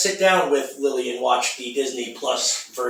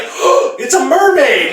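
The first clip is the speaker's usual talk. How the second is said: mock amazement; 10 semitones higher, 8 dB louder, slower than usual. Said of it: "I would be like".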